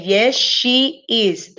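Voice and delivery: very slow, each word separate; slow correct answer. Shown as a demonstration. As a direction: spell out Yes, she is word by word. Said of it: "Tendría que ser". (la respuesta correcta, muy despacio)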